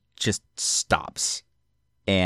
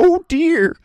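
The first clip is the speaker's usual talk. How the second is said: much higher, loud fretful gasp; much higher, loud old man's oh dear; frightened old man; 7 dB louder than usual. Acted. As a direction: fret like a timid old scholar. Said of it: "He's like".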